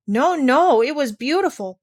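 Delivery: put-on girl protesting, about to weep; emotional protest. A narrator's reading. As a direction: insist it was wonderful, close to tears. Heidi protests, near tears.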